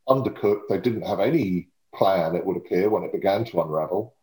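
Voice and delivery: normal talking voice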